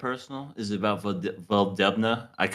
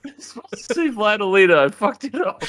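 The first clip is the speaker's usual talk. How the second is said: laughing; 10 semitones higher than usual. Real, not acted.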